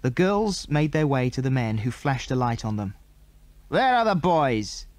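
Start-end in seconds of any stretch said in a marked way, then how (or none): 3.7-4.9 demanding. A narrator's reading. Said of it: "demanded the man".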